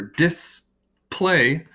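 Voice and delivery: display split as dis... play with a long gap; display pulled apart into two slow beats; syllable by syllable. Shown as a demonstration. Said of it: "has two syllables".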